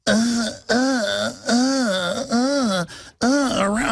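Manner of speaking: high, whiny mock stammer; mock whimpering. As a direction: imitate a cowering man whimpering.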